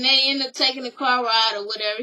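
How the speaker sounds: ordinary talk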